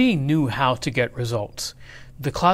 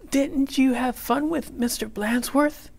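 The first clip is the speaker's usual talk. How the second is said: very high, put-on ladylike teacher voice; sweet teacher Miss Nelson; 11 semitones higher than usual. A narrator's reading. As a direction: ask the class sweetly, high-pitched. Miss Nelson questions the kids.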